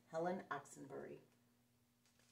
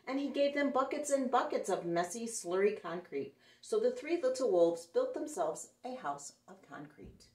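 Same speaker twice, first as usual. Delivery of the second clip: lively, louder read-aloud storytelling; story narration; 4 semitones higher, 9 dB louder than usual. A narrator's reading. Storyteller reading a picture book to children, lively and louder. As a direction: read it out big for the kids.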